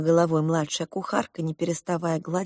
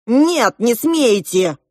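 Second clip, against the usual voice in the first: loud, higher protesting cry; cried out; 5 semitones higher, 7 dB louder, much slower than usual. A narrator's reading. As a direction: shout to stop him. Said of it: "выкрикнул Клоп". (протестующий выкрик)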